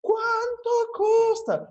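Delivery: high, drawn-out mock scream; hammed-up slow, high-pitched holler; imitated screaming. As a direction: stretch it out way up high, mimicking a screamer. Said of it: "if she were screaming like".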